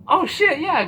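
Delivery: very high, loud burst of delight; excited reaction. Retold or imitated. Viewer seeing Nancy ask Dustin to dance, excited. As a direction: exclaim it high, thrilled at the turn.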